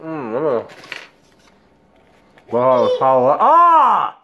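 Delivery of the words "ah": high, loud cry of burning pain; pained shout. Real, not acted. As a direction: yell out from the heat. Mouth burning from wing.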